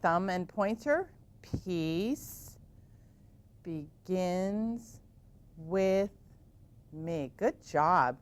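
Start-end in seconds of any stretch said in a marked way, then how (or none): 1.6-7.3 softer, drawn-out chant; slow mantra. Shown as a demonstration. Teacher guiding kids through the mantra slowly and softly. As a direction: breathe each word out slowly. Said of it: "So here we go".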